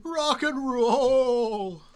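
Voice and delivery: drawn-out booming proclamation; hellfire preacher. Pretend. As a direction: preach it slowly. Mock preacher warning.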